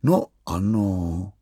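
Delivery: pomalu a přívětivě; vlídné, pomalé přitakání. Acted. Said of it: "odpověděl tatínek přívětivě". (tatínek vlídně a pomalu odpovídá synovi)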